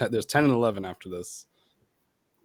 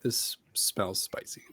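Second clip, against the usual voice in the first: slow hushed whisper; 3 semitones lower, 9 dB quieter, slower than usual. Real, not acted. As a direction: breathe it out in a whisper. Sniffing the hot sauce.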